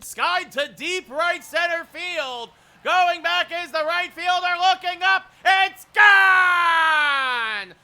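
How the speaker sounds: stretched-out broadcaster yell, building then easing off; home run call. Real, not acted. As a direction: scream it like a walk-off bomb.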